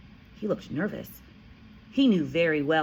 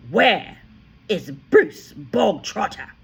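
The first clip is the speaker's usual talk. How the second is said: loud, slow, higher bellow; booming tyrant headmistress; headmistress bark; 5 semitones higher, 9 dB louder, slower than usual. A narrator's reading. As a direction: demand him like a drill sergeant. The Trunchbull barked.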